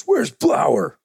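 high, barked call from a brawler; mock bruiser shouting for someone; tough guy in a bar. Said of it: "and he goes".

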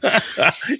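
high-pitched burst of laughter. Real, not acted. Laughing at the hammers joke.

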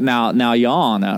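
a hint of a folksy Southern lawyer drawl. Preacher mimicking his inner Southern lawyer, a lightly done Southern drawl. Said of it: "like an old Southern lawyer".

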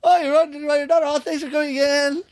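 very high, put-on polite greeting; awkward retold greeting. Performer greeting a family with young daughter at adult show, high and awkward. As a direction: act out a high-pitched, flustered hello.

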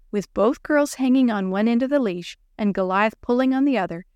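plain reading voice

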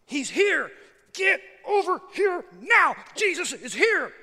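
loud, high, punched-out shout; urgent calling out. Acted. Religious leaders should be directing people to Jesus, urgent shout. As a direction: summon the whole crowd over, word by word.

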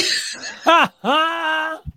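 giddy laugh. Real, not acted. Laughing on stage.

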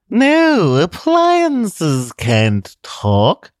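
high, slow, loud mock denial; talking refrigerator. Refrigerator denying that appliances talk, sly slow voice. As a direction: deny it slyly like a machine.

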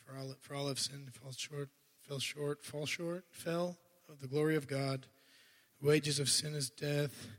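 a soft, flat-paced recital with no life in it, mimicking his old self; lifeless rote recital. Preacher mimicking how he once recited the Romans Road, lifeless and rote. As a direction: recite it like a memorized script. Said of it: "That's how I used to do it".

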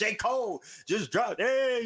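high, drawn-out excited announcement; excited fan voice, stretching the words; retold excitement. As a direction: relive the thrill of a new release, pitch way up. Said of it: "I'm excited".

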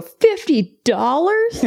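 high, slow, incredulous squeak with a laugh; shocked disbelief. Retold or imitated. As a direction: blurt it out in disbelief. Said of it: "without controlling myself at all, I go".

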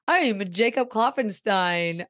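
normal talking voice

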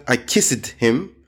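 slow "kissed" as two syllables, KISS-id; wrong -ed ending. Shown as a demonstration. Teacher showing the error, extra-syllable kissed. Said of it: "It's not".

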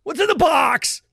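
high, loud, slow desperate yell of the movie line; Brad Pitt impression. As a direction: scream the line like the desperate detective. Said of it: "like Brad Pitt in Seven".

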